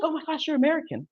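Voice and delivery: high, quick delighted gush; tickled delight. Retold or imitated. English lady meeting an American, tickled. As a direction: imitate someone thrilled by a foreigner. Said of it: "beyond tickle with my American accent".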